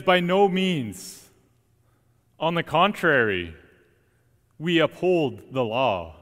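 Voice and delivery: slow, weighty, emphatic denial; firm preacher's emphasis, drawn out slowly; quite strongly. Retold or imitated. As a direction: lean hard on every word of the refusal. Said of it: "quite strongly".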